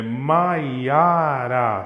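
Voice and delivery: "Maiara" stretched slowly over three notes; stress on middle syllable. Shown as a demonstration. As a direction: swell on the "ia" of "Maiara". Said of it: "a sílaba forte está no meio".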